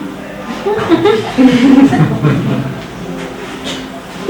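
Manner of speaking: quiet unvoiced laughter; soft wheezing laugh; long breathy laugh. Real, not acted. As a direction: laugh under your breath at the absurd question. Laughing at his question.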